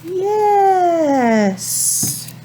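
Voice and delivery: modeled yes. Shown as a demonstration.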